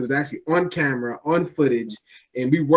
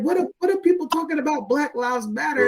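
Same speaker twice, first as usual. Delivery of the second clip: incredulous high voice; 11 semitones higher than usual. Retold or imitated. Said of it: "I was like".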